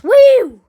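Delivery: loud high whoop; loud excited cowboy whoop. Pretend. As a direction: whoop like a rodeo rider. Cowboy whoop in Wild West game.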